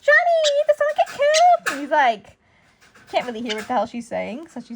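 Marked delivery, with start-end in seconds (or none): 0.0-1.7 put-on high, loud girlfriend voice; shrill mock girlfriend question, much higher; girlfriend asking. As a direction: call out like the curious girlfriend. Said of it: "she's like".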